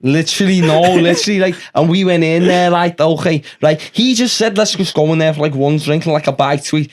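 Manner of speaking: thick put-on Scouse lad, chatty; Scouse accent. Lad telling his pal about last week, in a put-on Scouse accent. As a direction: play a Liverpool lad gossiping about his mate. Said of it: "sing in the Scouse accent".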